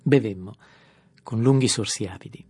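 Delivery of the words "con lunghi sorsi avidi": ordinary delivery, nothing marked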